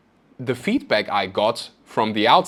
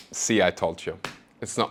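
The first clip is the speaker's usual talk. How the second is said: inner critic voice; 5 semitones lower, 8 dB quieter than usual. Retold or imitated. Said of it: "that little voice in my head would go".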